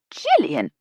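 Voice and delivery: loud, sharp reprimand; scolding. Acted. Ella reprimanding Jill, loud and sharp. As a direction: cut her off with the name.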